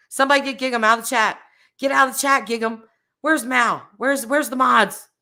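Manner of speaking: mock indignant streamer voice calling for the mods; mock outraged streamer. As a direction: ham up a streamer ordering chat bans. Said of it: "I'm just making fun of other creators that do that".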